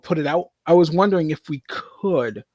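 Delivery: normal talking voice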